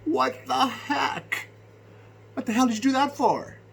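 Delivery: high, loud, stretched-out indignant retort; retold outrage. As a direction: shout the question back in disbelief. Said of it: "And I'm like".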